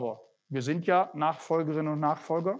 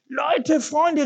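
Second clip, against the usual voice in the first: high, loud calling for help; 11 semitones higher, 5 dB louder than usual. Acted. Man calls friends to help clean, loud high call. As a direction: call to the neighbours through the open windows. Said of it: "rief er".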